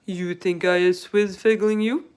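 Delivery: big gentle giant voice, loud; BFG voice. A narrator's reading. Narrator voices the giant to Sophie. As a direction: voice the giant with wonder. BFG voice in read-aloud story.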